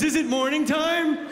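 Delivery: put-on high, loud little boy wonder; dazed kid. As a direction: ask it like a stunned child. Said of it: "He goes".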